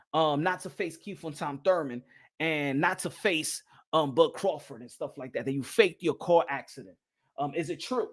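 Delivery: low, slow and soft, a hushed reporter voice; mock interviewer. Posing as a reporter asking Spence about the crash, low and slow. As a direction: lean in and quiz him low and slow.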